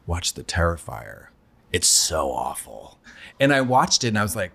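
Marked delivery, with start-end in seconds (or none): 0.1-3.0 low, slow, soft mock-ominous recommendation; lower, slower, drag out the dread; imitating Sharon. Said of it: "She's like".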